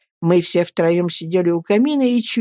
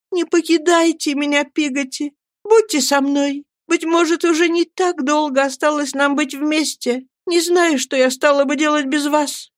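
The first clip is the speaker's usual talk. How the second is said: high, tender woman's voice, pleading; pleading gentle mother; 8 semitones higher than usual. A narrator's reading. (высокий нежный голос матушки, с мольбой)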